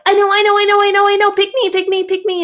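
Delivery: put-on high, loud, frantic kid begging to be picked; eager kid. Eager pupil begs teacher to pick them, high and loud. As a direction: squeal it like a desperate kid in class. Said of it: "the little kid that's like".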